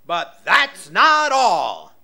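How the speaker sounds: slow, loud pitchman pitch; infomercial. Pretend. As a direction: sell it like a TV ad. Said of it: "I sound like an infomercial now".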